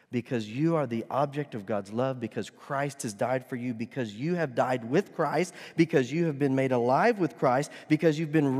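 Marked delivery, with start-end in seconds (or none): none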